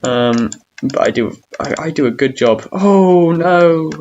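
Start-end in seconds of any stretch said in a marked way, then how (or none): 2.7-3.9 dismayed outcry. Real, not acted. Sudden game failure.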